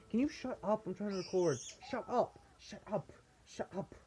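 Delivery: high, sharp telling-off to the cockatiel; high, exasperated shut up at the pet; scolding the bird. Real, not acted. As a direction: snap at the noisy bird to hush.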